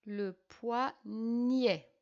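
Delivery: "Le poignet" drawn out very slowly, each syllable separated; slow dictation voice breaking "poignet" into syllables. Shown as a demonstration. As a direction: stretch "poignet" out, poi then gnet, for children writing. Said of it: "G N pour faire gne".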